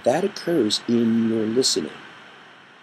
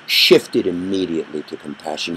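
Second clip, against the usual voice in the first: ordinary talk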